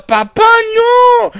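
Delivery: squealing high, drawn-out plea; loud hammed-up falsetto cry; mock anguished wail. Child pleads with papá after the arrow, in a mock anguished wail.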